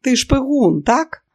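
high, eager prodding question; insistent. A narrator's reading. Pyera pressing Robert to admit it, insistent. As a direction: push for a yes, pitch climbing high. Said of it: "наполягала П'єра".

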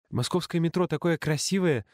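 put-on high bragging kid; boastful boy. Chubby boy bragging to the leader, high boastful voice. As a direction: show off proudly. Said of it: "хвастался толстенький мальчик".

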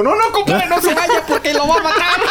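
very high, laughing plea; mock panicked warning. Moviegoer warning the talk-show guest, mock panic. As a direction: beg him frantically, hammed-up and laughing. Acts out warning a man on screen.

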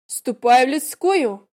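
loud, slow order to a servant. A narrator's reading. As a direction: command it like a lady to a servant. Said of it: "приказала барыня". (властный приказ)

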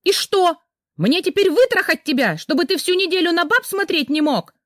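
high furious yell; wife yelling at husband, furious. Acted. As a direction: scream it at him in rage. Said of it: "заорала Рита".

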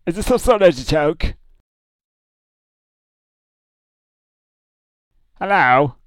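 high, loud bewildered hello; confused shout. Acted. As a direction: act baffled, calling out. Confused caller on voicemail.

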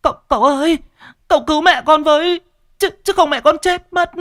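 put-on high, loud, sobbing little girl begging; panicked neighbor girl begging the master for help; tearful girl pleading. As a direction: cry for help in a high girl's voice. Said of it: "vừa chạy vừa mếu máo".

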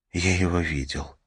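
thin, frightened little voice; a scared boy, barely holding it. Acted. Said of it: "В его голосе звучал тоненький, царапающий кожу страх".

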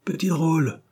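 high-pitched, half-proud outcry; proud exclamation. A narrator's reading. Dare speaking of his cheeky son, proud exclamation. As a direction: exclaim with a touch of pride. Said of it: "s'écria monsieur Dare, en apostrophant son fils avec un certain orgueil".

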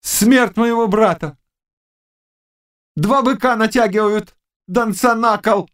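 high-pitched seer in a trance; witch crying visions. A narrator's reading. Witch seeing her brother's death, crying out.

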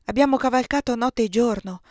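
usual speaking voice

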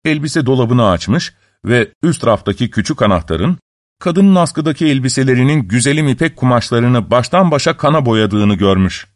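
plain reading voice

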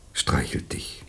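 very soft, voiceless words; intimate whisper. Acted. Jan describing his touch to Patricia, whispered. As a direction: let it fade into a breath.

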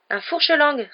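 "fourche-langue" repeated high and loud; insistent repetition. A narrator's reading. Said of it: "répéta Ron". (« fourche-langue » répété haut et fort)

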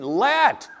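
sudden high, loud call; attention-grabbing shout. Shown as a demonstration. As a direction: punch out LET to grab the listener. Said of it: "this is trying to get your attention".

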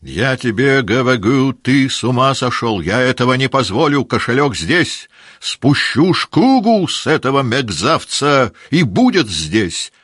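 rage, refusing to allow it; furious shouting. Acted. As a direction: Denisov shouting down Rostov. Denisov raging at Rostov.

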